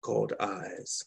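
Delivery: calm, measured poetry recital; gentle spoken-word reading; poem reading. Acted. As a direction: savor each image as you read. Reads second poem aloud.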